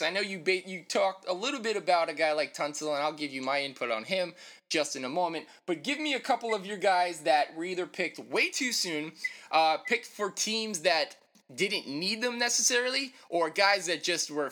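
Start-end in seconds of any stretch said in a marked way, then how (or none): none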